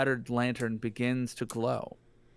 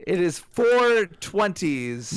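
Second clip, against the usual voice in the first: "It is four twenties." slow and firm, a little louder; stress on four twenties; 4 semitones higher, 4 dB louder, slower than usual. Shown as a demonstration. Player repeating the French number logic, slow stressed. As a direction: lean slowly on "four twenties". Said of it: "repeating the explanation".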